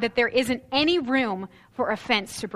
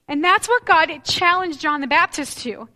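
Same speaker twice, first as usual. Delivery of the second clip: ordinary talk, nothing marked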